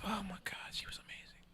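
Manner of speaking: hushed, reverent whisper; dreamy whispered aside. Real, not acted. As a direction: breathe it out softly, awestruck. Reminiscing about stripper Coco.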